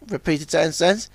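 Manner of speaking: usual speaking voice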